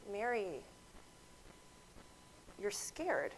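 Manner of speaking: softly, slowly, with long pauses; calm soft reassurance, no rush; gentle and soft. Retold or imitated. Doctor quietly naming the scared patient's feeling, soft and slow. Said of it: "I simply said to her".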